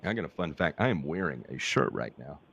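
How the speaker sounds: normal talking voice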